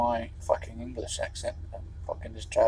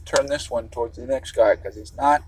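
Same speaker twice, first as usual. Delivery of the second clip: ordinary delivery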